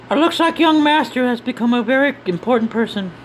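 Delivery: high-pitched character voice; game character. Acted. One character remarking on young master's rise, high character voice. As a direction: play them high and chatty.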